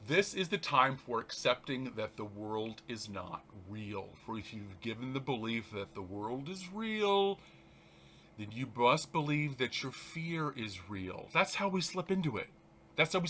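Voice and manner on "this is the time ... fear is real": slow, soft, prayerful reading; soft spiritual text read aloud; meditative reading. Acted. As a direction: let each line settle like a breath. Reads text slowly.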